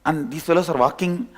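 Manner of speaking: ordinary delivery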